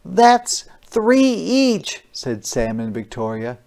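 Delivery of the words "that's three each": put-on child's voice. Kids counting their cookies.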